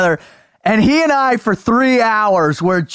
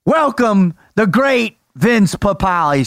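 ringmaster; 3 semitones higher than usual. Pretend. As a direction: announce the guest like a big-top show. Host introduces guest like ringmaster.

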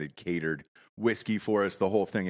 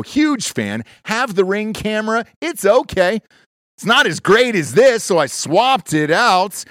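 loud, punchy ad read; 8 semitones higher, 12 dB louder than usual. Acted. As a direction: sell it hard like a pitchman.

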